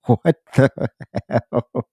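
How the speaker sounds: a man laughing at his own tears; laughing disbelief. Real, not acted. Laughing at himself crying.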